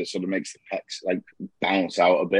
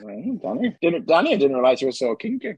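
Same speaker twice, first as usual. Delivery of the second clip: teasing; 6 semitones higher than usual. Acted. Teasing absent Danny.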